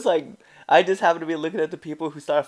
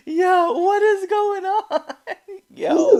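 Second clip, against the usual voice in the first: high laughing disbelief; 7 dB louder, much slower than usual. Real, not acted. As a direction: ask it while cracking up. Laughing at the match.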